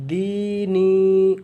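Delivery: دینی sung out slowly, long i held each time; دینی with stretched long i vowels; long vowels drawn out. Shown as a demonstration. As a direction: stretch دینی evenly for the learner. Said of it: "یہ دونوں میں یا مدہ ہے".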